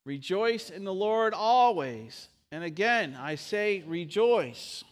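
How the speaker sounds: loud, slow, proclaimed reading of the verse; slow scripture reading. Shown as a demonstration. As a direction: read it out slowly and boldly. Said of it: "let's read together".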